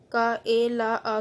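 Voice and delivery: slow, drawn-out separate sounds; teacher splitting a word into sounds; sounded out by letter. Shown as a demonstration. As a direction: say का, ए, ला, आ one by one. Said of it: "अलग-अलग करके भी दिखाया".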